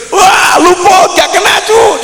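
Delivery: put-on high, excited teasing shout at a running child; playful chasing shout. Grandfather chasing his grandson, gleeful high shout. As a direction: call out gleefully like a grandpa at play.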